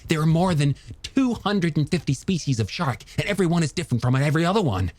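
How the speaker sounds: high, animated, rhapsodic character voice; passionate young scientist. Acted. Scientist defending sharks to a doubter, high impassioned voice. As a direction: rush eagerly through the wonders, pitched well up.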